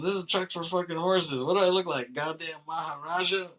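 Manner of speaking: mock outrage at the elephant, voice pushed high; angry trucker. Trucker scolding Manny about the elephant, mock angry.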